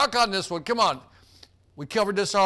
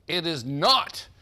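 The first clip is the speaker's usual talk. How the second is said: slow, each word apart, not pressed hard; stress on not; 2 semitones lower, much slower than usual. Shown as a demonstration. Host repeating the quiz question to players, stressing not. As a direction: spell out it is not, leaning on not. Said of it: "I want to emphasize the word not".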